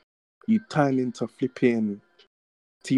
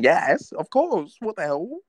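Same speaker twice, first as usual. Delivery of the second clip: high, eager instant yes; eager excited yes; 6 semitones higher, 3 dB louder, slower than usual. Retold or imitated. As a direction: blurt it out, thrilled. Eager yes quoted in story.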